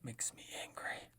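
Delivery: hushed grumbling whisper. Real, not acted. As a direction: whisper it to yourself. Whispered aside.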